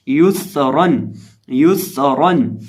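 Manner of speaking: युसरन slow, whistled स and heavy र; rounded ya, full ra. Shown as a demonstration. Teacher summing up the rules, slow युसरन with whistled स and full र. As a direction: round यु, hiss the स, thicken रन in युसरन. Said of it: "होंठों को गोल करेंगे और रॉ को पुर".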